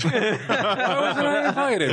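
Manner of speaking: high, drawn-out laughing complaint; mock indignation. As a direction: play the left-out friend, breaking into laughter. Joking as the offended friend.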